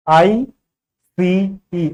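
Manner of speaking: letters spelled out very slowly, one by one; slow letter-by-letter spelling for a class. Shown as a demonstration. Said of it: "अब इसको ई मत समझ लेना".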